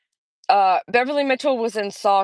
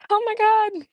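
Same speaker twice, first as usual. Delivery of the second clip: laughing; 12 semitones higher than usual. Real, not acted. Reacting to a viewer comment.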